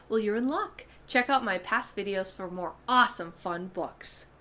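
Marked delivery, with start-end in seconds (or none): none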